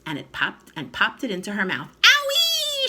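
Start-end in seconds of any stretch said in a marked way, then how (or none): 2.0-2.9 very high loud shriek; old grandma shrieking; whooping scream. A narrator's reading. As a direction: shriek with wild delight. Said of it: "she screamed".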